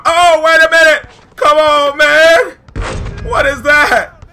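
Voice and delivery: loud, high disbelieving yell; worked-up outburst. Real, not acted. Player protests the mascot ruining his moment, worked-up yelling. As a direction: protest at full volume as the play turns. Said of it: "Did you hear how hype I was getting?".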